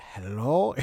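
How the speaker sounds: mock sleepy hello; imitated sleepy guy. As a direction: imitate a guy just woken up. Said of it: "he's like, he's, like, sleeping".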